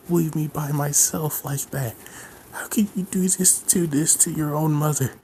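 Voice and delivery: high-pitched, whimpering mother's plea; tearful mother's lament, high and shaky; mock crying mother. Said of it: "crying, just like".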